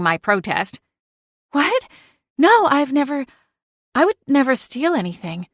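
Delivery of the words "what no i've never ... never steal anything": a flustered, high denial; stammered denial. Acted. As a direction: deny it, rattled and nervous. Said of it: "Melissa stammered".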